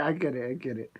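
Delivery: breathy whisper, half to himself; a sheepish whispered aside. Real, not acted. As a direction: whisper it under your breath. Muttering to self after a troll.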